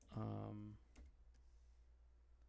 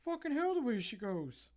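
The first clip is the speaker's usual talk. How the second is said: yelled much higher and louder, a fed-up trailer park rant; Trailer Park Boys quote; 11 semitones higher, 10 dB louder than usual. Retold or imitated. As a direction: bellow it like a furious trailer park guy quoting the show. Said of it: "Anybody ever heard of Trailer Park Boys".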